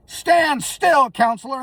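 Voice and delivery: a judge snapping from the bench; mock stern shout. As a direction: bark it up high like a strict judge. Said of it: "And she was like".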